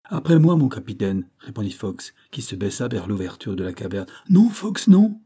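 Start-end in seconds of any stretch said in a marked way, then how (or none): 0.0-1.3 high, loud start dropping back; eager call to a superior; eager volunteering. A narrator's reading. Fox volunteers to go first. 4.3-5.2 high, loud cry of refusal; urgent shouted protest. A narrator's reading. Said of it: "s'écria Hod".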